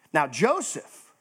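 normal talking voice

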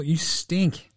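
ordinary talk, nothing marked

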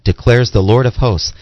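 ordinary talk, nothing marked

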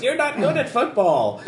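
high, mocking crab sneer; taunting crab voice. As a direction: jeer like a bullying crab. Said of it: "as the taunting crab voice".

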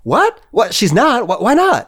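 high, incredulous stammer; shocked disbelief. Acted. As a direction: squeak out startled questions. Shocked that Doc isn't coming.

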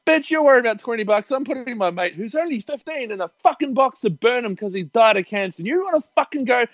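high-pitched imagined outburst; mock screaming rant. Grieving teen imagines screaming at the rude woman, mock fury. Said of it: "about to scream at her".

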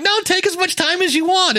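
high, syrupy patience; sweetly indulgent. Retold or imitated. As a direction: gush with lovestruck generosity. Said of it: "you're like".

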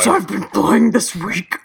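ghoul voice, hissed through teeth; breathy ghoulish hiss. Acted. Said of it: "I need my ghoul voice again".

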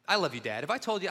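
ordinary talk, nothing marked

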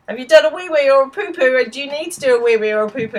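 high, exaggerated talk to a toddler; potty-training parent. Parent quizzes toddler during potty training, high child-directed voice.

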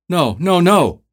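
high, loud panicked screams of no; screaming for help. Acted. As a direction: scream it out in terror. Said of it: "screaming for help".

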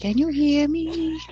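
high, slow, drawn-out mock echo; mock laggy voice. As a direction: stretch it out high like a bad mic. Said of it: "I was like".

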